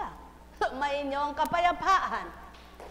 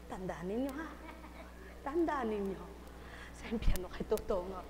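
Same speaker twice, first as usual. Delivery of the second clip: soft warning; 4 semitones lower, 7 dB quieter than usual. Shown as a demonstration. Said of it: "Eh, ihinaan natin".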